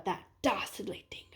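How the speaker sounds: fast, hushed whisper; whispered accusation. Acted. Kangaroo whispers who he blames, quick and hushed. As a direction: lean in and whisper the culprit's name.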